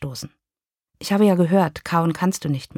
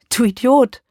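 higher, louder snap of scorn; exasperated insult; 6 semitones higher, 5 dB louder than usual. Acted. Wife scolds her ex-husband on the phone, exasperated shout. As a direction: bark the insult at him.

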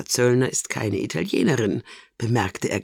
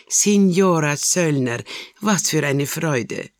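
higher, slow, louder joyful welcome; delighted greeting; 5 semitones higher, 4 dB louder, slower than usual. Acted. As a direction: throw the door open with joy. Carlotta greets visitor delightedly.

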